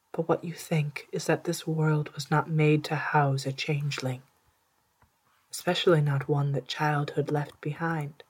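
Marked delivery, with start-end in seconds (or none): none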